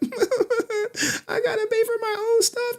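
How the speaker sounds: high, slow fake sobbing whine; mock crying. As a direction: blubber it like a crybaby. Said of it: "She crying like".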